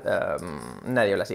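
ordinary talk

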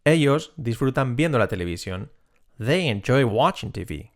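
ordinary talk

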